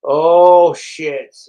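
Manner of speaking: sudden loud startled oh. Real, not acted. Watching a clip, blurts out a loud startled oh. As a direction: blurt it out, caught off guard.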